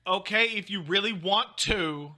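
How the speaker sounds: high, drawn-out mock wife voice; slow it down and lift it high, mimicking her; imitating wife. Husband redoing his impression of his wife, high and drawn out. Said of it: "Do I sound like that".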